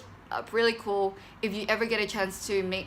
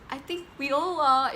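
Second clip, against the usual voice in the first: put-on girl answering; 7 semitones higher, 3 dB louder than usual. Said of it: "one of the girls".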